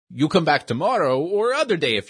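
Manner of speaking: lightly voiced old shopkeeper with a broken-English accent; a kindly old immigrant magician in broken English. Shopkeeper sends boy off.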